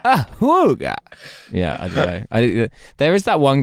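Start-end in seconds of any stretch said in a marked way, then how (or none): 0.1-0.9 very high honking awooga; ahooga horn. Acted. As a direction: blare it like a car horn. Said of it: "[name] do an awooga noise".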